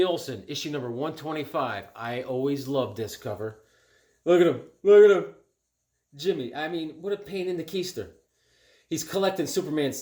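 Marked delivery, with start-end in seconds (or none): 4.8-5.3 excited repeat. Real, not acted. Collector showing the cover to viewers, excited louder repeat.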